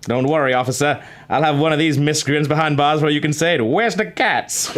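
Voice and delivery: put-on New York accent. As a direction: play a cocky New York detective. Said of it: "newfound New York accent".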